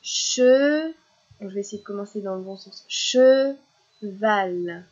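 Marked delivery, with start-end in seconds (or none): 0.0-0.9 "Che-" stretched, loud and higher; loud, lifted, lengthened "Che-"; syllable held alone. Shown as a demonstration. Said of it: "On va essayer". 2.9-4.8 "Cheval" with long, loud "che", pause, "val"; "Cheval" in two separate beats; split into syllables. Shown as a demonstration. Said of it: "la dernière syllabe".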